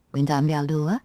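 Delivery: plain reading voice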